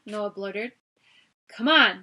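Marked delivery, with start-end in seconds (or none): 1.5-2.0 loud barked command; barked order. A narrator's reading. Guard barking at frozen kids, loud. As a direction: bark it at them. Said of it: "the guard barked".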